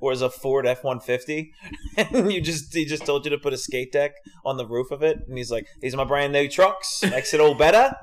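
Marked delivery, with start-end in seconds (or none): 5.8-7.9 pushed mock Australian sales pitch; Australian accent. Inventor pitching his brand new trucks, mock Australian accent. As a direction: sell it in a broad put-on Australian accent. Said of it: "in an Australian accent".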